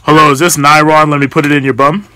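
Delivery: ordinary talk, nothing marked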